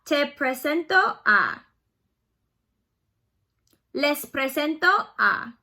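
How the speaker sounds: slow, clear teacher model; slow model for repeating. Shown as a demonstration. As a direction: lay each phrase out slowly to repeat. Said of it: "repeat those after me".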